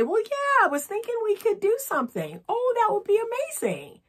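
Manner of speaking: bright high excited yes; delighted reply. Pretend. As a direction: gush with delight at the plan. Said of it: "that's how you're gonna get him to say".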